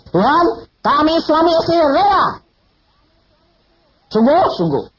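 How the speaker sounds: high, loud pleading prayer; fervent prayer. Retold or imitated. Preacher reenacts praying with wife, loud and high. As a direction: pray it out, surrendering the child. Couple praying, surrendering child.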